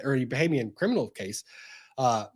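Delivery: usual speaking voice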